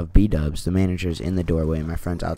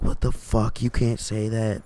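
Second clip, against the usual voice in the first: half-voiced imitation of screaming in protest; high-pitched retold outrage at conversational volume; 5 semitones higher than usual. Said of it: "in there screaming at this dude".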